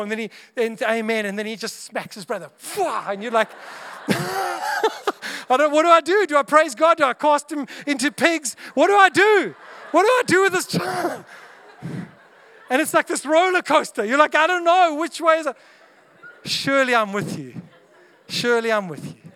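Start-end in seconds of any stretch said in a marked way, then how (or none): 4.1-11.2 high, loud, laughing panic; frantic parent. Retold or imitated. Parent whose child prays then smacks his brother, comic panic. 14.3-15.6 high, loud, laughing panic; frantic parent. Retold or imitated. Parent whose child prays then smacks his brother, comic panic. Parent confused on roller coaster.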